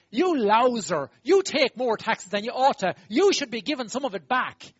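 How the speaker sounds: loud, slow scolding of a cheat; loud finger-wagging telling-off; mock scolding. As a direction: wag a finger and scold him loudly. Scolding a tax collector.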